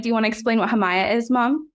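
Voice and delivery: ordinary talk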